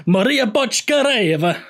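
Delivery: high, slow Italian-accented lilt. Shown as a demonstration. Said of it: "in an Italian accent".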